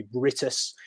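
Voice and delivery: Britus said with a short "i", BRIT-us; Welsh pronunciation. Shown as a demonstration. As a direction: say Britus the Welsh way, first vowel as in "bit". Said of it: "if it's in the Welsh pronun".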